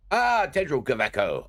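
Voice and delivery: NPC voice. Acted. Streamer voices NPC greeting.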